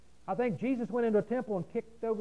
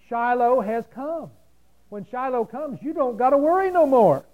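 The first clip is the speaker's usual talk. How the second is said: loud, high triumphant proclamation; preacher's rising, exultant cry; full-force preaching; 4 semitones higher, 10 dB louder than usual. Real, not acted. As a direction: build to a pulpit shout.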